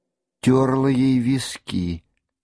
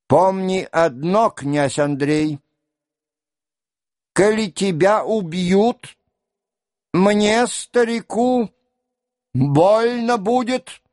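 put-on high-pitched old man's voice; old father addressing his son, pitched up; old father's solemn warning; 6 semitones higher than usual. A narrator's reading. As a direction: voice raised high for the old prince. Old father warns departing son.

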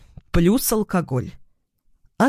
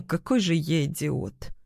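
ordinary delivery

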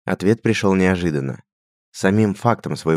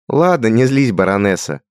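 higher, louder playful ribbing; teasing; 5 semitones higher, 6 dB louder than usual. A narrator's reading. Richard teases Radgar calling him baroness. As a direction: wave it off with mock sweetness. Махнул рукой Рихард.

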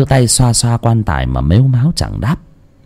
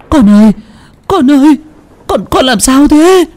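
much higher, louder panicked cry; frightened mother; 3 dB louder than usual. Acted. Mother Trang clutches her sleepwalking daughter, panicked and high.

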